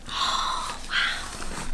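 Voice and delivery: whispered wonder; awed whisper. Acted. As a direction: breathe it out in awe. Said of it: "hushed wonder at room".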